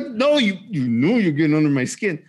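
mock-heated, starts high and falls; heated retort. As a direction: protest to a kid who is needling you. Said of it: "I'm getting heated".

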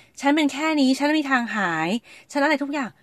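high, hammed-up self-pitying wail; mock drama queen. Mocking her own self-pity over illness, drama-queen voice. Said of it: "ไม่เห็นมีใครมาทำตัว drama queen เลย".

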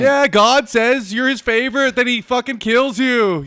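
loud, high mocking sarcasm; sarcastic. Mocking the letter writer's claim.

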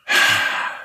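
a long unvoiced married-person sigh of frustration; exasperated sigh. Friend mimics a married person on the phone, a fed-up sigh. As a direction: breathe out a weary, fed-up sigh. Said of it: "anytime you hear a married person go".

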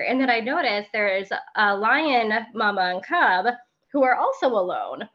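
usual speaking voice

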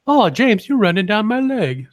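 a camp high swooning mother voice; mock mom moan. Host mockingly voices the cohost's mother mid-sex, high hammed-up moan.

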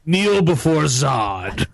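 slow, commanding villain voice; General Zod. Retold or imitated. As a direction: order them to kneel, slowly. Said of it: "What is it?".

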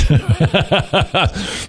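high, staccato chuckle; laugh. Real, not acted. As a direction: laugh ruefully at yourself. Laughs at his own failing.

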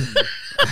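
quick high chuckle; a short laugh up high. Real, not acted. Laughing mid-sentence.

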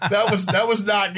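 real laughter breaking into the words; high, laughing confession; laughing while talking. Real, not acted. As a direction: admit it while cracking up. Laughing over his own confession.

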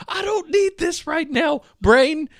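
high, slow, exasperated retold panic; mock panic. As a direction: plead with your own brain, hammed-up. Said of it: "I almost had a panic attack".

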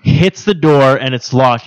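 ordinary talk, nothing marked

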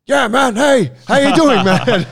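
high, loud, beaming greeting; imitating an overjoyed Wim Hof; excited Wim Hof impression. Friend retells meeting Wim Hof, high loud beaming voice. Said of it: "And he was like".

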